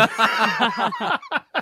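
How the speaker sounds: high laugh tumbling down; high burst of laughter. Real, not acted. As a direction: laugh hard at the memory. Laughing at own story.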